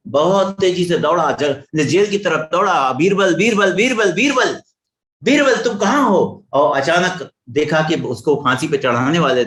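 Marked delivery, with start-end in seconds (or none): 2.9-6.4 calling out for Birbal. Retold or imitated. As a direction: call his name urgently, pitch climbing each time. King runs to jail calling Birbal. (ऊँची आवाज़ में बीरबल को पुकारना)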